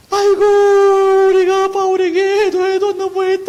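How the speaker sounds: very high, drawn-out keening at full volume; acted loud wailing. Corinthian believers repenting over how they treated Paul, acted loud wailing. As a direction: bawl out the remorse in a long mock wail. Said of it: "어떤 울음이 있나 하니까".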